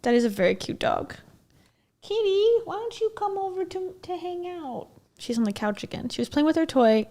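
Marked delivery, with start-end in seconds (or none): 2.0-4.9 high, soft coaxing to a kitty; sweet pet voice calling the cat. Real, not acted. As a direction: coax the cat off the couch.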